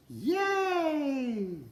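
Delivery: high squeaky yay; a silly high little yay; mock cheer.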